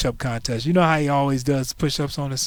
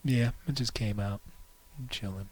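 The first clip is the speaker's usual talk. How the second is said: low, soft, unbothered; totally laid back, barely bothering; chill Rail; 7 semitones lower, 15 dB quieter than usual. Retold or imitated. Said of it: "And Rail's all like".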